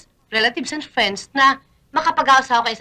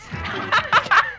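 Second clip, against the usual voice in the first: high burst of laughter; laughing; 11 semitones higher than usual. Acted. Woman laughs at her visitor's joke, laughing. Laughs at being called star-studded.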